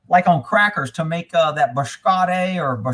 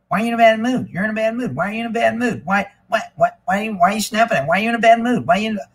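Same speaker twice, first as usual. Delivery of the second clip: higher, pestering mock nag; repeated whiny mock needling; nagging mock question; 4 semitones higher, faster than usual. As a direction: imitate people needling him about his mood. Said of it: "How would you like to hang around somebody?".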